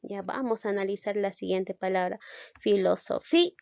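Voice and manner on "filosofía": "Filosofía" with the accented FÍ punched, A set apart; stress on FI. Shown as a demonstration. Teacher analysing the next word, stressing FÍ in "Filosofía". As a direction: hit the FÍ before the final A in "Filosofía". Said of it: "una de estas dos vocales está sonando más".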